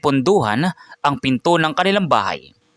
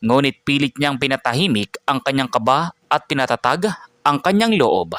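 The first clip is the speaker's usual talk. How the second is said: ordinary delivery, nothing marked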